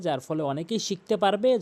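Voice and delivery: normal talking voice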